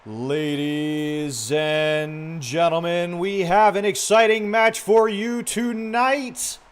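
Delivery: big, loud, high stadium announcer. Pretend. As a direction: call the match like a hyped arena announcer, stretching key words. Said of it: "like, my announcer voice going".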